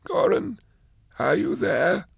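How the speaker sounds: weak high call from a cage; thin, high, frail calling out. Acted. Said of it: "a weak voice called".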